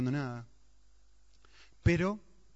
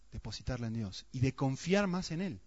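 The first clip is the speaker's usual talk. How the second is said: ordinary delivery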